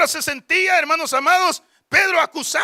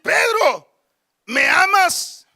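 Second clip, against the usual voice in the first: higher, slower repeated question; Jesus; 6 semitones higher, 4 dB louder, much slower than usual. Acted. Lord asking his disciple a second time, raised insistent question. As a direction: press the question, stretching the last word. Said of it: "Y le vuelve a decir".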